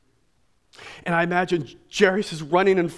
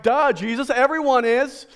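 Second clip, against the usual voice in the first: mock duh; 6 semitones higher, 4 dB louder than usual. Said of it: "his disciples are like".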